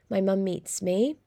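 usual speaking voice

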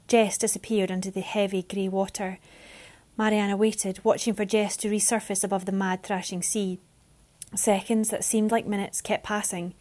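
plain reading voice

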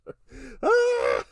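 shrieking high laugh; high cackle. Real, not acted. Podcaster cracking up at the caller, shrieking laugh.

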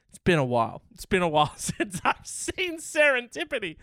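high, laughing admission. Real, not acted. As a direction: giggle it out.

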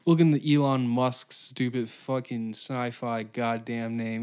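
ordinary delivery

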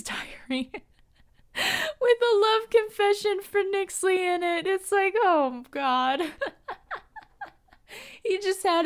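words broken by giggles; high, laughing retelling; laughing while talking. Real, not acted. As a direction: giggle through the telling. Giggling while recounting diary scene.

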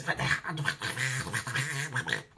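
lightly voiced, irritated cartoon duck; a hint of an annoyed Donald Duck; Pato Donald. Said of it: "com a voz do Pato Donald". (voz leve de Pato Donald irritado)

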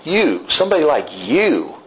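mocking disbelief, lightly voiced; a touch of contemptuous scoff; dripping scorn. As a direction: sneer at the idea of rebuilding. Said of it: "There's this dripping scorn".

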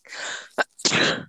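real sneeze; sudden sneeze; sneezing. Real, not acted. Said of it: "Bless you again".

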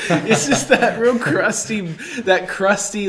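laughing while describing it. Real, not acted. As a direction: giggle through the words. Laughing about grandma's chocolate.

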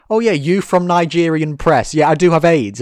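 high, slow, breezy mock confession; lightly voiced offhand Freddie; hammed-up casual admission. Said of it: "come out and go".

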